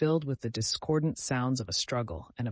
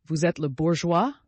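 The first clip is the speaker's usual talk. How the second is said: French officer; 4 semitones higher, 6 dB louder than usual. A narrator's reading.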